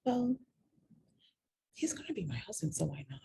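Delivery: whispered self-persuasion; hushed inner voice rationalizing. Retold or imitated. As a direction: talk yourself into it under your breath. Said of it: "you start to rationalize".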